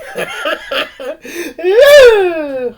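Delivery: loud laugh that goes up high; high shrieking laugh. Real, not acted. Shrieking laugh at the boy's spanking video. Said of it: "That was funny".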